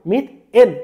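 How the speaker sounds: মিথ এন loud and high, in two parts; ending sounded out. Shown as a demonstration. Teacher building an alkane name for students, ending sounded out. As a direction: punch out মিথ, then the ending এন. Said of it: "এন উচ্চারণ করব".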